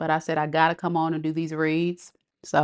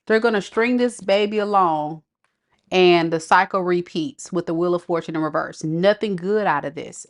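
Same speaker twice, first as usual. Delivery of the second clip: ordinary talk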